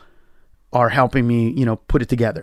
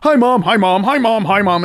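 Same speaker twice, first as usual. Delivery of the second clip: athletes greeting mom; 6 semitones higher, 3 dB louder than usual. Retold or imitated. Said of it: "I see a montage of".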